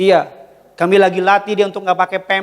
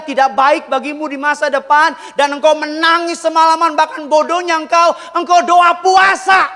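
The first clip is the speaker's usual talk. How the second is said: impassioned preaching; 8 semitones higher, 4 dB louder than usual. Real, not acted. Pastor comparing the heavenly Father to himself, preaching building to a shout. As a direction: build from raised voice to high shouting.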